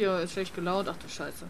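ordinary talk, nothing marked

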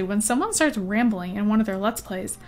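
normal talking voice

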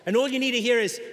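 ordinary talk

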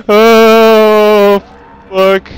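loud shocked gasp and curse; jump-scared, yelling; startled scream. Real, not acted. Jump scare in horror game.

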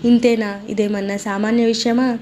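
plain reading voice

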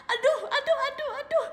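giggly, high aduh aduh; laughing. Real, not acted. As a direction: laugh it out, overwhelmed. Overwhelmed by the gift. (tertawa kegirangan)

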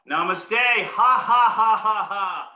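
slow bowing namaste then forced ha ha laughs; bow and laugh out loud on each ha; namaste laughter. Shown as a demonstration. Leading the class in namaste laughter. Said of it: "Put our hands together in front of us and bow".

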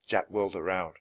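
ordinary delivery

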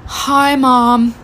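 long feeble sighs, then a hammed-up greeting; badly faked sick child; milk it like a kid faking sick; dramatic fake illness. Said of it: "so dramatically, like".

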